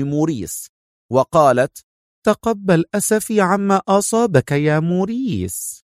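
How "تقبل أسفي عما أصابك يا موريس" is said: higher-pitched woman's character voice; lightly acted female sympathy, pitched up; sympathetic woman. A narrator's reading. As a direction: offer condolences in a raised feminine voice. Woman character offers her sympathy.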